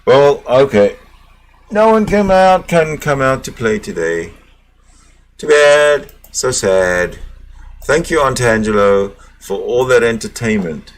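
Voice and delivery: loud, upbeat stream sign-off; loud outro. Real, not acted. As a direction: wrap up the stream loudly like a host.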